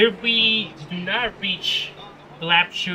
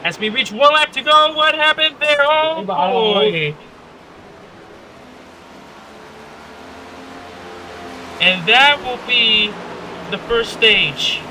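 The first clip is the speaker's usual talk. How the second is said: motorsport commentator; 5 semitones higher than usual. Acted. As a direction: call the restart with mounting excitement. Race restart play-by-play.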